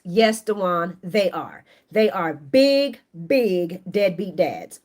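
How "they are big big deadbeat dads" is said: drawn out, every word leaned on; slow emphatic. Real, not acted. Scolding the show's deadbeat dads, slow and emphatic. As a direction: spell out the verdict slowly.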